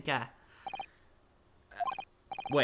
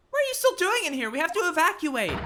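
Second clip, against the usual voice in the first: higher and louder, hurrying them out; urgent warning; 5 dB louder than usual. Acted. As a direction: sound the alarm about the fire. Urging evacuation.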